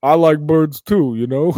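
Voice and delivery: put-on clumsy punch-drunk wooing; Rocky. Boxer asking his girl to dinner, slurred impression. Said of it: "He's like".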